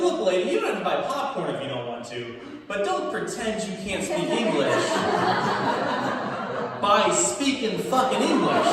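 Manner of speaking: hammed-up inner outburst; exasperated rant. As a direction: vent at her with mock fury. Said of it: "in my head I'm like".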